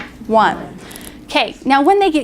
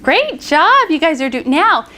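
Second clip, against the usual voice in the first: excited praise; 5 semitones higher than usual. Shown as a demonstration. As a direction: cheer the kids on. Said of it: "praising the class".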